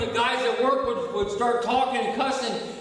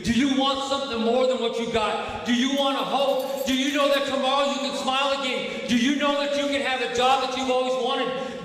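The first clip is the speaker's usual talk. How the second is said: rising preacher's plea; 4 semitones higher than usual. Acted. Preacher calling hope to the recovery group, rising. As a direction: pitch climbing, stress on know each time.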